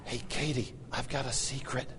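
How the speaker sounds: put-on dad hushing to stop a running toddler; secret whisper. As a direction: lure her in with a hushed secret. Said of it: "I just said".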